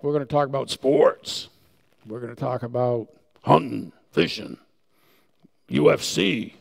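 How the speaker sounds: typical guy talk. Retold or imitated. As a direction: play the regular guy.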